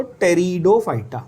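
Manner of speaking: "pteridophyta" said as "teridophyta", no P, straight T; silent P. Shown as a demonstration. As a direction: start "pteridophyta" right on the T sound. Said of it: "पी नहीं बोलना है, टी बोलना है सीधा।".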